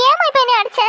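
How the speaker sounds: put-on high-pitched child's complaint; hurt protest. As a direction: protest at being smacked, pitch shooting up. Hit child protests to mother.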